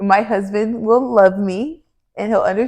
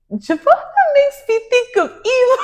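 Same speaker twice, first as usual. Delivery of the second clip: high laughing shout; laughing high-pitched retelling; laughing squeal. Retold or imitated. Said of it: "And they said".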